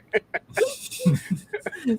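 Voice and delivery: high-pitched giggling laugh. Real, not acted. Laughing at sepia filter.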